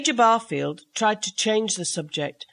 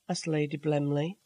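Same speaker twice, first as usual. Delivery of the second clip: usual speaking voice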